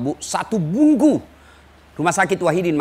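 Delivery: ordinary delivery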